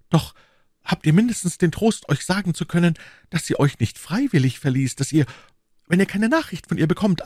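lightly voiced lovelorn young musketeer; a hint of a character voice, earnest and wounded; D'Artagnan. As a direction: confide your loss to a friend, mildly troubled. Voices D'Artagnan telling his sorrow.

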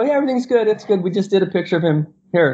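smooth it over in a high voice; retold hasty reassurance. Reassuring the lobby in story.

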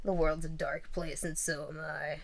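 soft, understated doom quip; mock gloom. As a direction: drop it quietly like a joke confession of darkness.

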